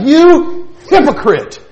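slow, loud and high, each word hurled; Jesus turning on the ruler with fire in his eyes; Jesus rebuking the hypocrite, blazing; fiery rebuke. Acted. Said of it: "the fire in Jesus' eyes".

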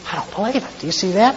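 hushed amazement. Acted.

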